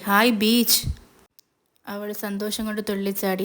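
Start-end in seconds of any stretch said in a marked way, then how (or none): 0.0-0.9 happy cry. A narrator's reading. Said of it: "പ്രിയക്ക് ഭയങ്കര സന്തോഷമായി".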